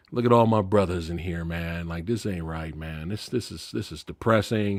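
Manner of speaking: low, soft, downcast murmur; getting emotional. Retold or imitated. Pryor seeing his brothers in prison, quietly emotional. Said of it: "He started getting emotional".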